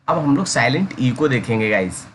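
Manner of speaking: normal talking voice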